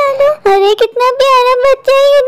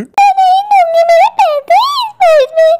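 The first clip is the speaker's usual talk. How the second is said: distressed refusal; 4 semitones higher than usual. Acted. Woman clinging to her purse from a robber, high and distressed. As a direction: cry out in panicky refusal.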